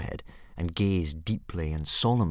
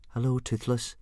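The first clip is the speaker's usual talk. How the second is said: put-on Dragonese accent; 4 semitones higher than usual. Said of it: "in what he hoped was a good accent in Dragonese".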